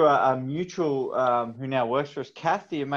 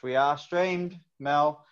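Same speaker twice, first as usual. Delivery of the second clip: usual speaking voice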